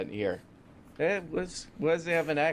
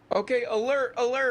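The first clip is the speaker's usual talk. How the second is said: high, raised-voice news alert; mock alarm; 10 semitones higher, 4 dB louder than usual. Host alerts listeners to breaking news, mock alarm. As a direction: sound a hammed-up warning, pitched well up.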